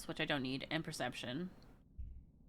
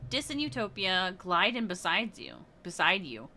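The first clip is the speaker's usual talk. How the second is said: ordinary talk, nothing marked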